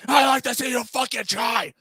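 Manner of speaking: high-pitched, shouted mock rage; pissed off. Friend daring the prank caller before slamming the phone, furious impression. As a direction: yell it like the friend blowing his top. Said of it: "He was so pissed off".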